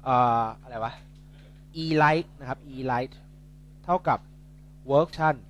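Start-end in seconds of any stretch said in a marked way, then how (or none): 1.8-3.1 E light said very slowly; slow emphasis. Shown as a demonstration. Tutor writing the formula for students, slow deliberate emphasis. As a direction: drag out E light so it sticks. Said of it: "tutor stresses key term".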